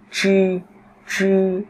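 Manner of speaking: "chư" with tongue curled back, unaspirated; curled-tongue sound. Shown as a demonstration. Teacher drills students, "chư" retroflex and unaspirated. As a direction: hold "chư" high and level, no air puff. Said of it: "Các bạn quặt lưỡi lên".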